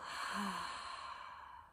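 long, soft breathy sigh; demonstrated exhale. Shown as a demonstration. Teacher showing listeners how to exhale, long releasing sigh. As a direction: let all the air go slowly. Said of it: "exhale, let go".

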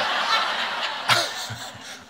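breathy burst of laughter; real laugh. Real, not acted. Laughs at near fly mishap.

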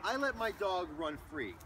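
ordinary talk

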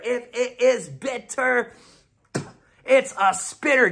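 slow, high, spelled-out "If it is bitter"; emphatic rule. Shown as a demonstration. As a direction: hammer "bitter" and "spitter" with a pause between. Repeats rule for listeners.

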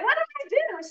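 high, indignant squeak; mock whiny protest. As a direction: play the confused daughter, way up high. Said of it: "she's like".

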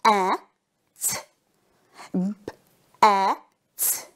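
each letter sound split out with pauses; sounds said one by one. Shown as a demonstration. Teacher to young pupils, sounding out letters with pauses. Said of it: "Here we have the sound b".

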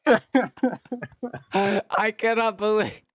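high, loud laugh through words; cracking up, can barely talk; laughing hard. Real, not acted. Teammate's crash reaction.

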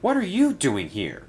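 high-pitched, surprised question; mildly gasped surprise. A narrator's reading. Daisy questions her boss's visit, in mild surprise. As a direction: ask it as if caught off guard. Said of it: "gasped Daisy".

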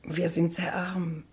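slow, hushed whisper; a slow whispered confession of poverty. Acted. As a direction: whisper it like a shameful secret.

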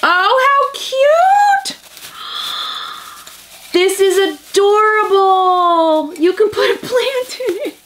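high, slow gushing over something cute; gushing squeal. Real, not acted. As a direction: coo over it like a puppy.

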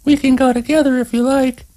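put-on high, quick girl's voice, offhand; young woman voice. A narrator's reading. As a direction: offer casually, hiding feelings. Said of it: "not wanting to sound as if she was feeling sorry".

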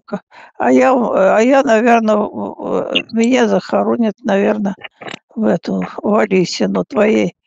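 normal talking voice

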